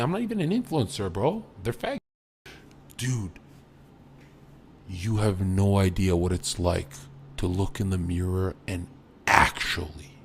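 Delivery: ordinary talk, nothing marked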